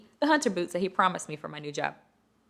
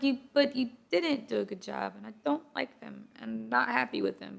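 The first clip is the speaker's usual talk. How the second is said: soft, higher-pitched mock complaint; meek retold complaint; 4 semitones higher, 8 dB quieter than usual. Unhappy client to nail tech, meek and soft. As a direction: retell it small and soft, pitched up.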